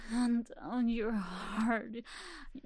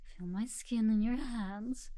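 ordinary delivery

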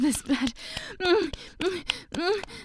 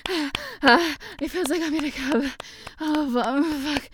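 breathy climbing moans of pleasure; aroused moaning; 4 semitones higher than usual. Acted. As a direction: pant and moan as pleasure builds.

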